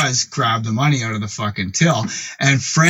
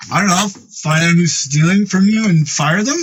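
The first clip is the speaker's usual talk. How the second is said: higher voice climbing into a question; unsure teenage suggestion; 5 semitones higher than usual. Retold or imitated. Sixteen-year-old advising an older boss, tentative and questioning. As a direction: offer advice as if guessing.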